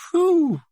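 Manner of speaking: high, loud blown-out whew; mock whew. Narrator reels from the garlic with a loud whew. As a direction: puff out the garlic fumes.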